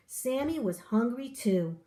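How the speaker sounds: usual speaking voice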